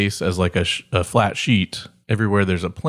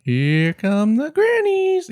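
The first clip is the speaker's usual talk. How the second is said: put-on very high, slow old granny voice; much slower than usual. Showing a friend how a granny sounds. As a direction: squeak it like a tiny old lady. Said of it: "That was a little bit Scottish".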